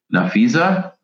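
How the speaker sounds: usual speaking voice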